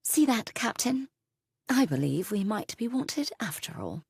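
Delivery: lightly voiced British accent, sweet and high; a hint of a sugary British lady needling the captain. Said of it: "her British accent adding an extra dab of sweetness".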